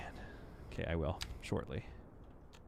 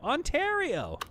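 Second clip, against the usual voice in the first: excited exclamation; slower than usual. Real, not acted. Streamer reacting excitedly to a viewer's home province. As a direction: pitch leaps up high in delight. Echoing a viewer's chat message.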